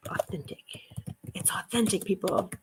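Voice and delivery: whispered aside. Real, not acted. Reading a shirt tag to herself, whispered. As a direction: breathe the word to herself.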